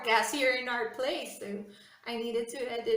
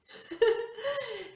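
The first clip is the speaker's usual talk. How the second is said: a loud, high laugh bursting out; high burst of laughter; 11 semitones higher, 3 dB louder than usual. Real, not acted. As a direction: laugh out loud at your own teasing. Laughs at her own joke.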